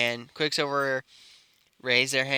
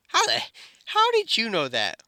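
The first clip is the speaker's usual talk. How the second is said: much higher, caught-out sputter; flustered surprise; 9 semitones higher than usual. Retold or imitated. Bakugo caught out about the girl he likes, flustered. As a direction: blurt it high, thrown off balance.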